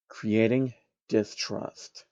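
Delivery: ordinary delivery, nothing marked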